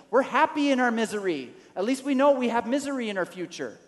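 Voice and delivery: high, loud whining complaint; complaining Israelites. Acted. Israelites complain loudly to Moses, high whiny protest. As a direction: voice the grumbling people, loud and high.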